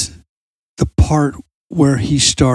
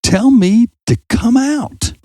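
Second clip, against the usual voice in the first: retold yell; 8 semitones higher, slower than usual. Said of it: "he starts yelling out".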